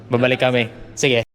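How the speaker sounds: ordinary delivery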